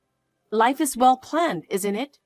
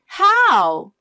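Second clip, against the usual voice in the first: desperate cry; 5 semitones higher, 6 dB louder than usual. Acted. Desperate question.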